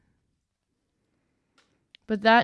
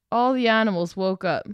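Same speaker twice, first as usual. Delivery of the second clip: plain reading voice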